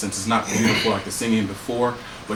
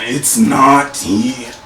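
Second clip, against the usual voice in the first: much louder and slow, rough and booming; loud gravelly creature voice; 2 semitones higher, 9 dB louder, much slower than usual. Acted. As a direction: drag it out like a harsh alien chorus. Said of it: "it's a very loud voice".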